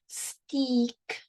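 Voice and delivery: "steak" read as "steek" with a long ee vowel; misreading as steek. Shown as a demonstration. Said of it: "Wait a second".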